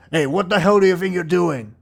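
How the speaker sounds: loud, high burst of outrage; indignant tough demanding an answer; angry bruiser. Acted. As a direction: confront a troublemaker at full volume. Bruiser confronts the table-thrower.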